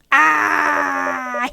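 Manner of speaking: loud startled yell; startled shout. Acted. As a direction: yelp as you're grabbed. Attacked in scene.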